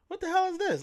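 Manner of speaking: high-pitched, incredulous retold reaction; bewildered outburst. Man retelling being woken at night, bewildered. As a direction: jump up high in baffled disbelief.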